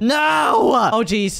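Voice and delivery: sudden high, loud yell of panic; panicked shout. Real, not acted. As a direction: yell no as you're chased. Being chased by sick Nico.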